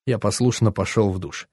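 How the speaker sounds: usual speaking voice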